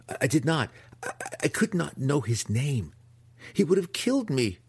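high, stammering self-defence; panicked stutter. A narrator's reading. Accused impostor pleads with the matron, stuttering high. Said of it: "Alton stuttered".

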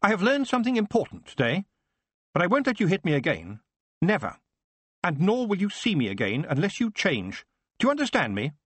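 lightly firm, formal and high; oddly formal. Acted. Son setting terms to his father, lightly formal. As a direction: speak with new formal resolve. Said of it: "His tone was oddly formal now".

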